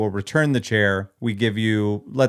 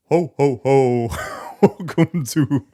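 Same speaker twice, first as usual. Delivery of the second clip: jolly Santa ho ho ho; 3 semitones higher, slower than usual. Pretend. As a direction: play Santa, slow and jolly. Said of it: "What does Santa sound like?".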